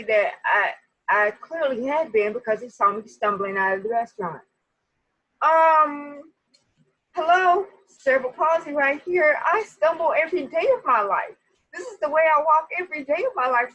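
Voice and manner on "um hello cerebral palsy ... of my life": exasperated hello, pitched up and loud; sarcastic, louder disbelief; you've got to be kidding me; indignant. Retold or imitated. Indignant retort to cop.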